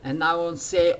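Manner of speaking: normal talking voice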